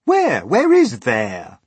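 high, loud demand, stretched at the end; insistent loud questioning; crowd asking. A narrator's reading. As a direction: press the question as an impatient crowd. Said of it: "they asked".

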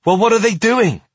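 high, loud demanding question; upset child. A narrator's reading. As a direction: insist on knowing, as a worried child.

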